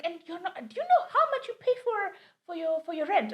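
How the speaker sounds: high, rising scold with a laugh; angry landlord. Retold or imitated. Landlord demanding rent on the phone, high scolding. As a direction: berate them about the rent. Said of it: "It's like".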